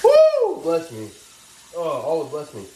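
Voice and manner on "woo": big high sneeze shouted out; loud whooping sneeze. Real, not acted. Sneezing on camera mid-story, loud whooping sneeze. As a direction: let a sneeze burst out with a whoop. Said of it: "good sneeze".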